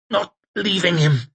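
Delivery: high, tight hissed refusal; straining, stubborn hiss; strained hiss. A narrator's reading. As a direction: hiss it through gritted teeth. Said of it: "hissed Temple".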